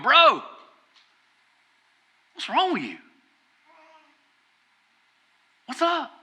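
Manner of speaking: high-pitched mock self-scolding; [name]. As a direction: confront yourself in the mirror, pitch leaping up. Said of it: "looking in the mirror and going".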